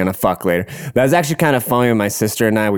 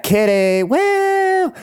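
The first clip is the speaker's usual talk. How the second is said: very high name, then drawn-out whoop; mock whooping cheer; much slower than usual. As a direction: echo it high and whoop in imitation. Echoing the pet name, whooping.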